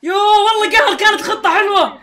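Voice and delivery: very loud, high lament; frustrated outburst. Real, not acted. Player laments good plan ruined, loud frustrated wail. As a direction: wail the frustration at full volume.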